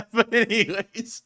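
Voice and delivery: ordinary talk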